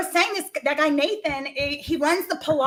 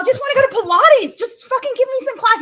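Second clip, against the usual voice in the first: whiny self-quote; 9 semitones higher than usual. Retold or imitated. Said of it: "I was like".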